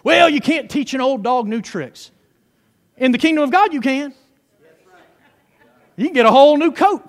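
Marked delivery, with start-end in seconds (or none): none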